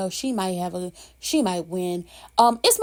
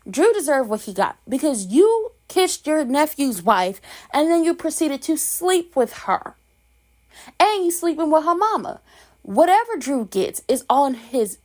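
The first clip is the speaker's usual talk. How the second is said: worked-up rant; 6 semitones higher, 3 dB louder than usual. Real, not acted. Ranting about Drew getting beaten.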